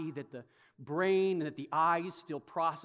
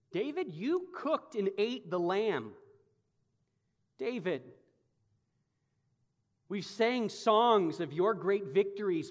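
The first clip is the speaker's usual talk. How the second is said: preaching at full force; 5 semitones higher, 5 dB louder than usual. Acted. Preacher confronting David.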